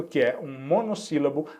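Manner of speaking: normal talking voice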